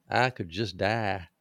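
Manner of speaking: slow, stretched North Carolina mountain drawl; mountain North Carolina accent. Retold or imitated. Retelling how mountain folk talked, slow North Carolina accent. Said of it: "In the mountains, they'd say".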